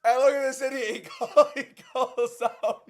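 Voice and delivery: yelling while cracking up; loud laughing disbelief. Real, not acted. Laughing at fighter's callout.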